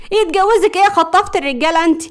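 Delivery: high, loud jealous outburst; loud, furious. A narrator's reading. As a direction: burst in shouting at a rival. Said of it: "بتقول بصوت عالي".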